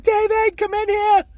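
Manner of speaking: high, loud panicked call for David; mock scream. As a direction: shriek for the son in mock alarm. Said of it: "she calls me fucking screaming".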